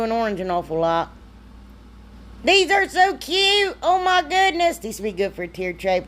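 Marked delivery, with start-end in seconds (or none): 2.4-4.8 squealing high and slow over how cute they are; real high-pitched delight; gushing delight. Real, not acted. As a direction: gush like you just saw something adorable. Gushing over finished crates.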